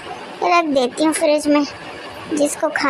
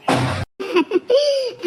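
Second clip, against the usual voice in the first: a burst of high laughter; 8 semitones higher than usual. Real, not acted. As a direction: laugh out at the joke. Laughing at scooter story.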